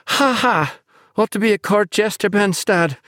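high mocking girl's voice; mock him with a fake laugh; sarcastic mockery. A narrator's reading. Naomi mocks Ben sarcastically.

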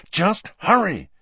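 high, urgent command; impatient. A narrator's reading. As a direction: hurry them along impatiently. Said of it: "said Harry impatiently".

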